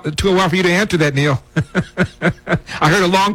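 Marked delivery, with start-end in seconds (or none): 1.5-2.8 short real chuckle; a warm laugh from the host; amused laugh. Real, not acted. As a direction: laugh at the caller's pause. Host laughs at long pause.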